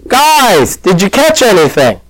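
high, drawn-out mock yell; acted call across the water, no real shouting. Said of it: "somebody yells from the beach".

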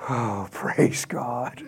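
slow, hushed sigh of relief; drawn-out, low, breath-out praise; mock relief. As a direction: let it out softly, as if amazed it worked. Mocks surprised relief at the miracle.

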